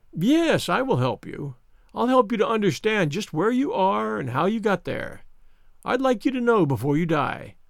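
high-pitched, self-satisfied villain; Mister Smith, smug. Acted. Visitor who sniggered at sick Holmes, smug. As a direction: sneer at the helpless patient. Narrator voices sneering visitor.

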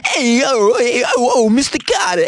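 put-on very high, over-the-top Jersey accent; rowdy high-pitched New Jersey accent, milking the joke. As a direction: ham it up as a squawking Jersey caricature. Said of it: "With a Jersey, Jersey accent".

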